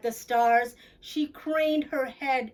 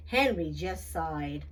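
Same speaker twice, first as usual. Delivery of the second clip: ordinary delivery, nothing marked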